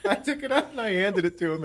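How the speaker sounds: high-pitched, talking through real laughter; laughing. Real, not acted. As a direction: struggle to get the story out through laughs. Cracking up while telling story.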